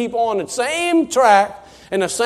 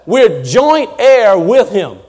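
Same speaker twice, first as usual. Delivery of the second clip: slow emphasis; 6 dB louder, much slower than usual. Real, not acted. Preacher quotes Paul slowly.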